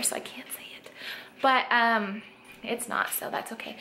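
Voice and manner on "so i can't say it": whispered aside; whispering. Real, not acted. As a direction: whisper so the dog won't hear. Dog nearby, hiding the word.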